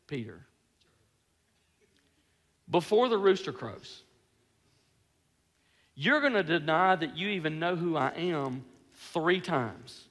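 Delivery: low, soft, measured warning; grave prediction. Acted. Jesus foretelling Peter's denial, quiet and grave. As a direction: break the hard news quietly, with long pauses.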